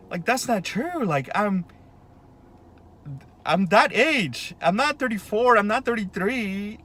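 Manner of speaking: ordinary talk, nothing marked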